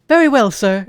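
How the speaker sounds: a hint of a posh British servant; mock British butler. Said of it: "Do I sound British?".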